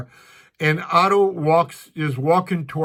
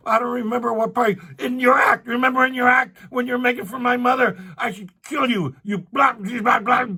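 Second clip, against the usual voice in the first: retold screaming; 10 semitones higher than usual. Angry stranger threatens the comic outside the club, mock yell. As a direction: shout it like he's about to swing. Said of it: "He's, like, screaming at him".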